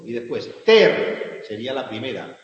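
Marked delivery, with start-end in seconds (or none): 0.6-1.4 ter hit loud and falling; marking ter as the strong beat; strong syllable. Shown as a demonstration. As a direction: stamp the stressed syllable. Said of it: "aquí sí tendría que ser fuerte".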